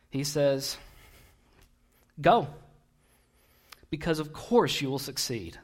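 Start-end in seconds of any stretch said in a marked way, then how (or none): 2.2-5.6 mock-agreeing prophet, dry and sarcastic. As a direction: say yes while meaning no. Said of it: "because this is sarcasm".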